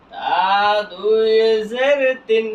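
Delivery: letter names stretched long and joined up; slow model spelling for learners; drawn-out spelling recitation. Shown as a demonstration. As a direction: hold দুই and জের long as you spell. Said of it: "বানান করলাম কি?".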